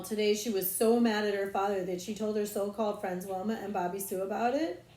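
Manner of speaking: ordinary delivery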